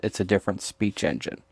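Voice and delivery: ordinary delivery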